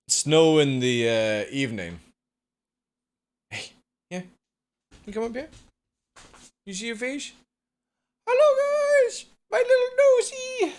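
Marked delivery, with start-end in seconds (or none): 3.5-7.3 high, soft pet-talk voice; soft high coaxing to a pet. Real, not acted. Talking to the pet. 8.3-10.7 gushing high-pitched pet greeting; excited squeal. Real, not acted.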